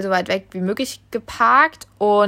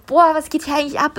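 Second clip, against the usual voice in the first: higher-pitched, stunned quoted question; retold astonishment; 6 semitones higher, 4 dB louder than usual. Reacting to screaming and fireworks near midnight, retold astonishment.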